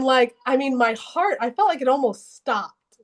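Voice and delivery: ordinary delivery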